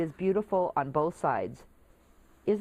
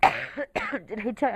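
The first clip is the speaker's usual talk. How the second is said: coughing; 3 semitones higher, much slower than usual. Real, not acted. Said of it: "I'm so sorry about coughing".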